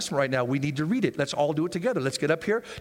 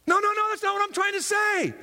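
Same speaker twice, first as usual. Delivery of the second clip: protesting excuse; 14 semitones higher than usual. Acted. Voicing protesting believer.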